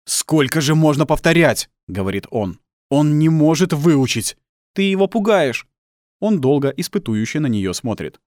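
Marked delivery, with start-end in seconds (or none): 0.0-1.7 loud, raised-pitch complaint; fuming father; angry exasperation. Acted. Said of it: "покраснев от гнева". 2.9-4.4 slow, loud, raised-pitch complaint; fuming father complaining; angry exasperation. Acted. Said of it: "покраснев от гнева". 4.7-5.7 high, loud reproach; protesting mother. Acted. Mother protests at angry father.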